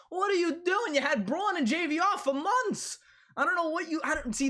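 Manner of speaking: outraged fan losing it over the trade deadline; incredulous outburst. Real, not acted. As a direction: nearly shriek it at the manager in disbelief. Fan berating the Flyers GM.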